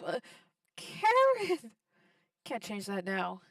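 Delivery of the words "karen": sudden high, loud call of her name; shocked cry. Real, not acted. Player aghast at Karen's cannibal joke, shocked shout. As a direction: yelp her name in disbelief.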